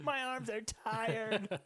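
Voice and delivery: high, slow, put-on weary voice; mock tired beater. Joking as the stepfather after the beating, slow high weary voice. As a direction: drag it out, high and worn out.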